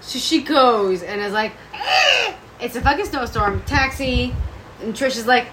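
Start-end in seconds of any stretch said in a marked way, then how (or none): 1.7-2.3 high dismissive eh. Retold or imitated. Dawn's dismissive noise.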